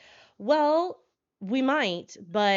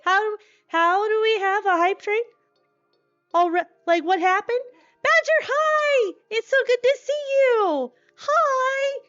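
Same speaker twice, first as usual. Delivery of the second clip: high and loud, bursting with delight; excited squealing; 11 semitones higher, 7 dB louder than usual. Real, not acted. Streamer overwhelmed by a hype train, high loud squeals. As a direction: shriek hellos at arriving friends.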